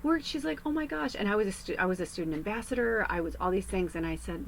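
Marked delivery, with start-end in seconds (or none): 0.0-1.1 much higher, earnest teacher's voice; pleading teacher. Retold or imitated. Teacher pleading with a student who gives up. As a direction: plead with her to care.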